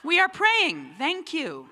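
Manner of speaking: high, loud final snap; curt mom. Retold or imitated. Mom cutting off the telemarketer, high curt snap. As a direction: shut the call down hard. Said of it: "mom ends call".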